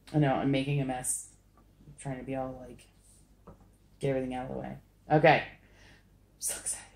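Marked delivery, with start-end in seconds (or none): 5.5-6.8 slow, hushed whisper; barely voiced, drawn-out whisper; excited whisper. Real, not acted. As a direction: whisper it like a thrilled secret.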